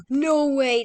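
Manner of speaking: loud, high burst of disbelief; no way, can't believe it; excited. Real, not acted. Finds bunker chest.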